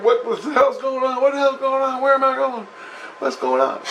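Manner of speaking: high, frantic mock screaming; panicked screaming. Imitating the guy screaming as the porthole opens. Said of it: "He starts screaming".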